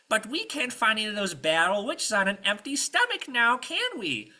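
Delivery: high, loud cajoling witch; coaxing witch. Acted. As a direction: coax the knight to keep eating. Witch coaxes knight to eat.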